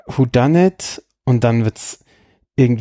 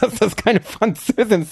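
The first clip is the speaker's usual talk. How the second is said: laughing; 7 semitones higher than usual. Real, not acted. Laughing at a poorly faked French.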